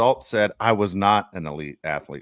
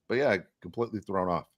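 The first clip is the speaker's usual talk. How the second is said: normal talking voice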